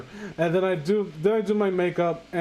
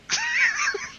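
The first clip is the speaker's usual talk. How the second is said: shrieking laugh. Real, not acted.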